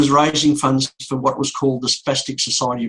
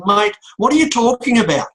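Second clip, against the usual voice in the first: higher, louder, exasperated challenge to the policeman; angry demand; 7 semitones higher, 4 dB louder than usual. Retold or imitated. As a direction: snap at him in disbelief. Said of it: "I got really mad with him".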